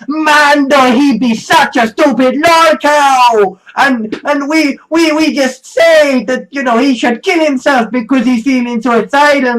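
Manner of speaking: mock a gloating idiot; mocking dumb troll. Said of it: "are just like".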